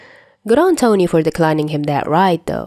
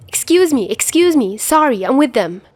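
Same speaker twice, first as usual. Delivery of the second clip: higher woman's voice, polite but firm; 7 semitones higher than usual. A narrator's reading. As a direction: push through the queue politely, firmly. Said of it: "polite but firm".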